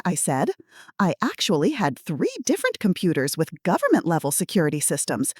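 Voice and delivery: ordinary delivery, nothing marked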